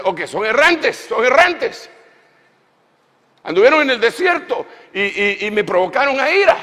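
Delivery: loud, high, angry voice of God; God's anger. Acted. Preacher voicing an angry God at the wanderers, loud and high. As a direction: thunder out God's wrath at the wanderers.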